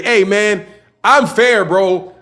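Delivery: normal talking voice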